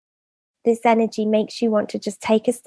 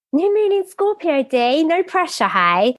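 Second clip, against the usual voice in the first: playful mock pressure; 8 semitones higher than usual. Real, not acted. Teasing her co-host about pressure.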